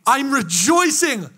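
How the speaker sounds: high, loud, drawn-out mock jubilation; exaggerated slow, exultant shout; hammed-up rejoicing. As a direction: belt it out with over-the-top joy. Acted answer to puzzled kid.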